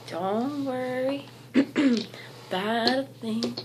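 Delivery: strained hoarse voice, drawn out; hoarse, losing voice. Real, not acted. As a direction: voice giving out, slow. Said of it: "I'm losing my voice".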